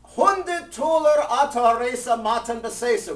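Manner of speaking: loud sing-song shout of the pledge; called out. Retold or imitated. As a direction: announce the big pledge across the shul. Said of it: "he calls out to the president".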